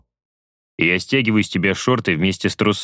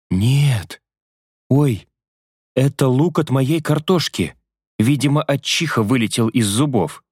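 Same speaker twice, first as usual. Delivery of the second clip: higher, flustered female voice; embarrassed woman; 5 semitones higher than usual. Acted. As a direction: blurt the excuse, flustered. Vasya embarrassed.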